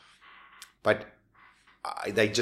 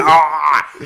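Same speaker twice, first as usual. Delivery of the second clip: startled yelp; 4 semitones higher, 11 dB louder than usual. Retold or imitated. Shocked at shark, retelling.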